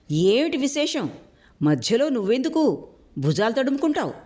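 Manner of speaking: high, sneering jibe; poison-tipped taunt; venomous mockery. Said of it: "విషపు నవ్వు నవ్వాడు". (విషపు వెటకారంతో)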